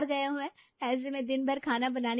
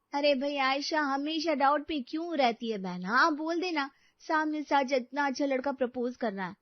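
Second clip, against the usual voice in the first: usual speaking voice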